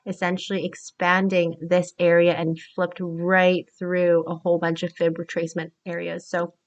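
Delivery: ordinary delivery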